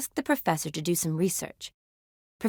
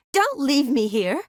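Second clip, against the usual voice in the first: higher, slow plea with rising end; anxious plea; 5 semitones higher, slower than usual. Acted. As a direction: plead slowly, voice lifting. Pleading not to be abandoned.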